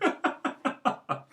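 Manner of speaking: short high unvoiced laugh; amused airy chuckle; high breathy laugh. Real, not acted. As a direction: laugh at your own joke. Laughs at own acted quote.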